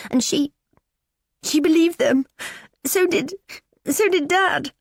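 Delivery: put-on high, strained teen voice on the verge of tears; a bullied girl pleading to be believed; tearful teenage girl. Daughter confiding bullying to mum, tearful and high.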